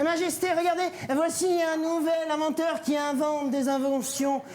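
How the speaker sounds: high, ceremonious presenter voice; high-pitched herald; eager courtier. Acted. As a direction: announce it to the king in a high put-on voice. Plays courtier presenting an inventor.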